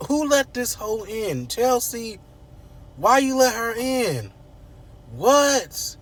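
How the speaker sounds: high-pitched mock outrage; high, indignant retelling of a shocked family; outraged household. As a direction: exclaim in shrill indignant disbelief.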